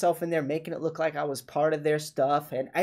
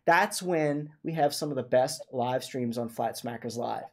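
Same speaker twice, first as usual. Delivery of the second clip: usual speaking voice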